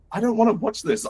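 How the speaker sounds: high-pitched, comic reluctant complaint; playful acted-out whine of refusal; mock protest. Acted protest at forced videos.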